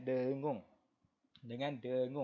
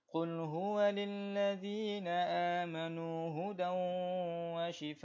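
Quran recitation; 4 semitones higher than usual. Shown as a demonstration. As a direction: recite for students to follow. Teacher recites Quran with tajwid.

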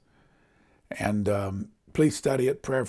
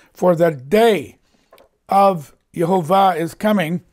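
raised, loud scripture proclamation; full-force preaching; 6 semitones higher, 6 dB louder than usual. Acted. Preacher warning of the day of Jehovah, full-force preaching. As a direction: declare the coming day with force.

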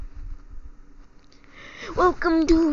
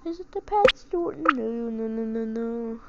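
normal talking voice

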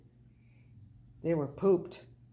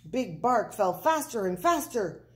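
plain reading voice

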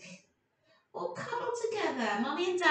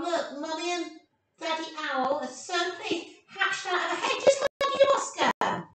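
ordinary delivery, nothing marked

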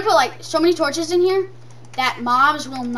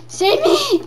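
squeaky hammed-up cry for help; playful panic; high mock plea; 11 semitones higher, 4 dB louder than usual. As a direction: beg in a high voice. Pleading as zombies attack.

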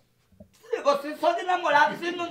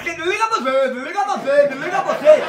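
mocking lovey call; 5 dB louder than usual. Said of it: "Por que você fica aí duas horas".

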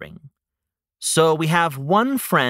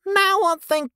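excited; 14 semitones higher than usual. Acted. Character struck by an idea.

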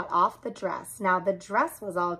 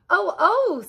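high, alarmed "oh, oh"; alarmed cry; 11 semitones higher than usual. A narrator's reading. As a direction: sound the alarm, worried. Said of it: "Sally said".

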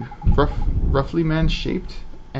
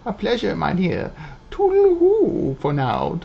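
put-on old lady voice; 6 semitones higher than usual. Owl shopkeeper farewell.

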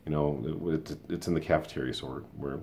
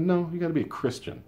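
much higher, sing-song scolding; mock preachy teacher. Mimicking a gym teacher scolding an eighth grader, mock preachy. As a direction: parody her self-righteous insistence. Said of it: "She said".

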